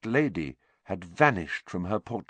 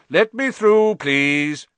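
loud, high, officious call; self-important; 8 semitones higher, 8 dB louder, slower than usual. Acted. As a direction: push through the crowd sounding important. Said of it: "came bustling importantly".

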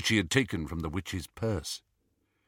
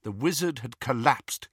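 plain reading voice

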